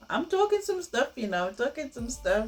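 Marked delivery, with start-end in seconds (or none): 0.1-1.1 pitched way up, teasing pride; high playful boast; playful brag. Real, not acted. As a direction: show off your point with a grin in the voice. Boasting about her own advice.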